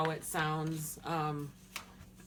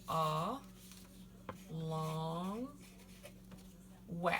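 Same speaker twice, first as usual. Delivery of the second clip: "a long we" split into three syllables, long pauses between; sounded out; 6 dB quieter, much slower than usual. Shown as a demonstration. Teacher teaching the word for star, "a long we" sounded out syllable by syllable. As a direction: break "a long we" apart, drawing out "long". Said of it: "So the stress is on the second to last syllable".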